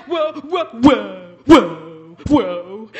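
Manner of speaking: scared squirrel whoa whoa, dropping low; a nervous little squirrel pulling back. Acted. Nervous squirrel voiced in tale.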